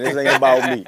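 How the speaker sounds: loud, amused, slightly high; real laugh breaking through the words; laughing deflection. Real, not acted. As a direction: laugh off the attention. Laughing off the attention.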